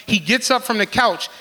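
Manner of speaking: normal talking voice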